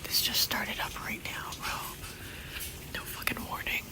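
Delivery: barely voiced, hushed complaint; whispered disbelief. Real, not acted. Vlogger remarks on something starting without warning, whispered. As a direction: whisper it to the camera.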